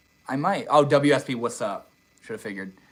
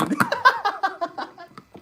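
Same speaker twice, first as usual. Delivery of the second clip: high laugh. Real, not acted. Streamer cracking up at a viewer's nickname. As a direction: burst of high-pitched laughter.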